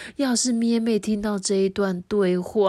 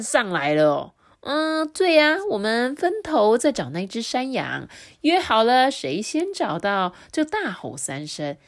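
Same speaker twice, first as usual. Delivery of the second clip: greedy wolf bragging, a touch higher; lightly voiced bully wolf, eager about the goat; hungry wolf leader sharing the plan; boastful boss wolf; 7 semitones higher, 6 dB louder than usual. Bali describing the goat hunt.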